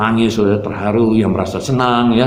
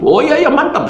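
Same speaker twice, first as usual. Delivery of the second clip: high-pitched, delighted retold reply; excited "yes, great" voice; eager agreement. Eager reply to father's wish.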